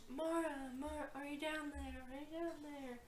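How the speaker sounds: soft, fading echo of a call. Acted. Pit returns the call for Mara, echoing faintly. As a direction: repeat it fainter each time, like an echo. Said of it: "Echoes back up".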